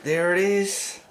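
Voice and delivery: ordinary talk, nothing marked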